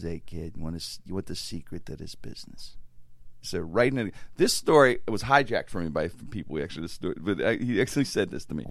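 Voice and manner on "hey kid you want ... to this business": very low, hushed voice; confiding old hand. Acted. MC offering a young comic the secret of the business, confiding. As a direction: take the youngster aside, low and soft. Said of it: "Ronnie one time".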